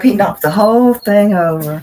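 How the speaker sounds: normal talking voice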